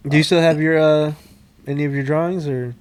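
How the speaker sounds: usual speaking voice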